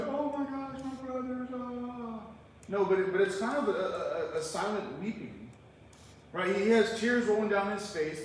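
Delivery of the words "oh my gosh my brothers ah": high, soft mock wail with a drawn-out end; mock sobbing. Preacher showing how Joseph did not weep, mock sobbing. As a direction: wail it softly, hamming up the sobs. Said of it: "he's not sobbing, crying".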